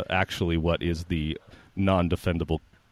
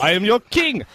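loud, higher royal claim; king voice; 12 semitones higher, 6 dB louder than usual. Retold or imitated. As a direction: declare it like a king. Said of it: "thank you for that performance".

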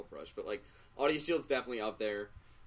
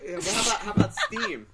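laughing; 10 semitones higher, 9 dB louder than usual. Real, not acted. Laughing plug of Steam.

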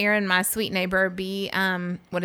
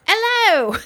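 imitating British guy; 12 semitones higher, 6 dB louder than usual. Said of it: "and he's like".

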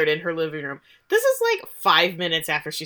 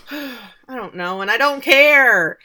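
a sigh, then slow, building to a loud CARE; drawn-out fed-up outburst, rising higher at the end; exasperated dismissal; 4 semitones higher, 7 dB louder, slower than usual. Real, not acted. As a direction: sigh it out, then belt the last words. Exasperated outburst after mock voiceover.

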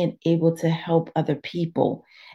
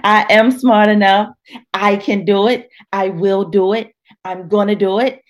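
loud, higher, defiant declaration; Joyce Myers voice; 3 semitones higher, 6 dB louder than usual. Retold or imitated. Preacher urging her listeners, loud and defiant. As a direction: shout it out like a fierce affirmation. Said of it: "in my Joyce Myers voice".

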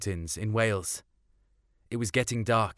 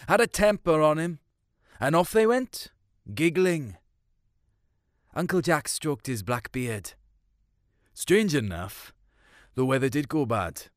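ordinary delivery

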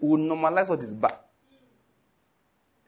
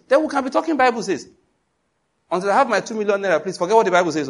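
higher, louder mock of a greedy pastor; 6 semitones higher, 3 dB louder than usual. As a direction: ham up the pastor demanding money. Greedy pastor retold, raised voice.

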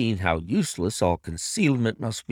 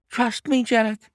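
high imploring cry; wife crying out; 12 semitones higher, 3 dB louder than usual. A narrator's reading. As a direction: beg for trust in a high wife's voice. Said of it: "she cried".

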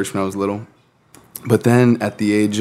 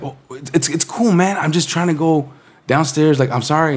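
put-on higher, nervous kid excuses; mock flustered kid; 6 semitones higher than usual. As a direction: stammer it out like a caught kid.

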